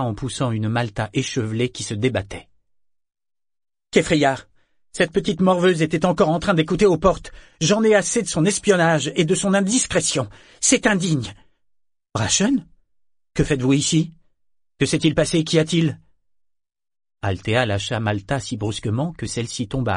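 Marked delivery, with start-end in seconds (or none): 3.9-11.3 higher, louder indignant scolding; outraged, raised-voice complaint; exasperated outburst. A narrator's reading. Exasperated aunt dragging niece in. 12.1-16.0 higher, louder surprised questions; taken aback, voice raised; startled questioning. A narrator's reading. Startled to find Brachen there.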